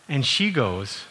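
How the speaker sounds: usual speaking voice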